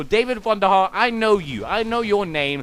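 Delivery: ordinary delivery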